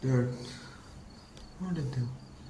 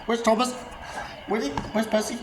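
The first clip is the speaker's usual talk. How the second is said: high, raised frantic calling; 13 semitones higher than usual. Acted. Asking Toby where the missing friends are, frantic. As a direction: call out names in a high panic.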